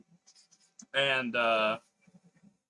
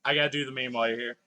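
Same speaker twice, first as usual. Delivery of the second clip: ordinary talk, nothing marked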